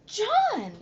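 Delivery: put-on sharp, high, loud cry of the boy's name; exclaiming mother. Mother scolding her son in the doctor's office, a high loud exclaim. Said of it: "exclaimed Missus Midas".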